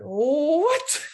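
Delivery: loud, high disbelieving what; incredulous what. Retold or imitated. She hears he thinks the place is too expensive, stunned and loud. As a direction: throw the word back at him, stunned.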